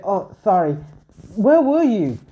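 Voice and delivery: rising, loud, anxious question; worried high cry. Acted. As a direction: demand an answer in a panic. Hestia worried after hugging Bell.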